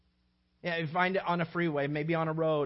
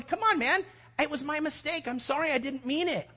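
higher, pleading voice; pleading apology; 8 semitones higher than usual. Retold or imitated. Driver pleading with the one behind, raised pitch. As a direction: beg the other driver to let it go.

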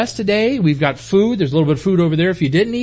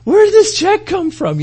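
mock surprise; 12 semitones higher, 4 dB louder, slower than usual. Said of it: "and we were like".

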